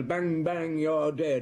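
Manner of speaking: toy-gun mockery; mocking child's game.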